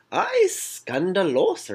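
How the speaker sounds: high, slow, put-on drama-queen "ay, scandalos"; mock scandalized gasp. Gossiping recap host, playfully scandalized. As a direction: drawl it out like juicy gossip. Gossiping about a shock elimination.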